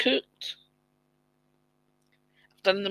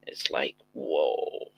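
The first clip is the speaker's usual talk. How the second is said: whispering; 4 dB quieter, slower than usual. Real, not acted. Dazed aside about brain fog.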